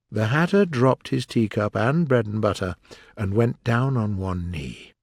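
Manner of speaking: ordinary delivery, nothing marked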